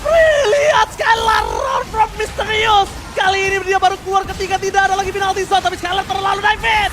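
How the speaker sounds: loud, high hype play-by-play; fighting game announcer. Real, not acted. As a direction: shout the fight call like a caster. Caster yells during teamfight.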